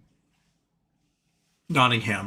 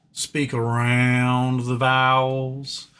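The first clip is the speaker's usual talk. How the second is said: slow Southern accent with stretched vowels; Southern drawl; much slower than usual. Shown as a demonstration. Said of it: "more like drawls".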